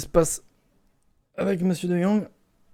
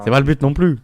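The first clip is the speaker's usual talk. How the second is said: loud emphatic; 7 semitones higher, 11 dB louder than usual. Real, not acted. Repeated line, louder take.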